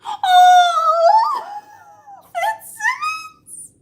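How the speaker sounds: huge high, loud gasp and drawn-out cry; gasping squeal. Real, not acted. Fan reacting to the couple reuniting, gasping squeal. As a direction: gasp and shriek the name in delight.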